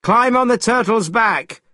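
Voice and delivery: high-pitched call to companions. A narrator's reading. Monkey tells the others to board, calling out. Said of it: "called Monkey to the others".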